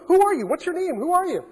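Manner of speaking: a hint of a shrill phone voice demanding a name; mock confused supervisor. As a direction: play the woken supervisor demanding who he is.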